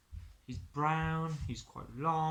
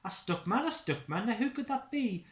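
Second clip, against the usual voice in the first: rising, higher wondering; curious Stickman; 8 semitones higher, 4 dB louder than usual. Acted. Stickman wonders who is stuck, curious and high. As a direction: puzzle it out aloud.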